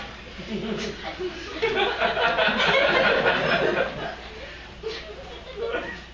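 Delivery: long high cackling laugh; wild high laugh. Real, not acted. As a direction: let out a long shrill laugh. Laughing at his own story.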